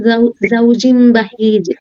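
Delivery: സൗജിൻ ബഹീജ് drawn out slowly, every sound clear; slow recital demo. Shown as a demonstration. Teacher modelling the phrase for a student, very slow.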